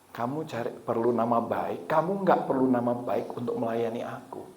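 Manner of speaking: soft, measured inner voice; calm, hushed imitation of God. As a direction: speak quietly and deliberately, as if inside the heart. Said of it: "ini kayaknya Tuhan yang ngomong".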